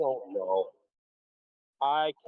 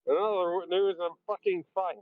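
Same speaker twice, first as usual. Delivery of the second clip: put-on old newsreel; 7 semitones higher than usual. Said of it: "you sound like a nineteen forties newsreel".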